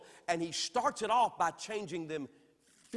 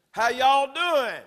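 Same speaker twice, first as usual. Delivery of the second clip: slow, loud, higher Southern "How y'all doing?"; Southern drawl; 7 semitones higher, 13 dB louder, much slower than usual. Retold or imitated. Preacher showing how Southerners greet, slow drawl. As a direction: stretch it out like a Georgia native. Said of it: "somebody said, You must be from Georgia or Alabama".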